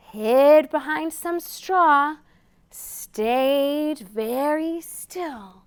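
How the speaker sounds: gentle picture-book read-aloud; storytime reading. A narrator's reading. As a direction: read it slowly to little listeners.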